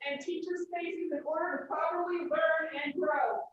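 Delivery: faint and distant, off the microphone; mic switched off. Real, not acted. Parent at school board keeps pleading after her mic is cut, distant and faint. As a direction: keep talking after the mic cuts out. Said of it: "I'm gonna turn this back on".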